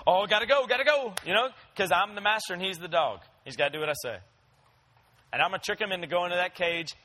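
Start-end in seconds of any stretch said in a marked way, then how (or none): 0.1-1.1 hurried dog voice. Pretend. Dog rushing to cage.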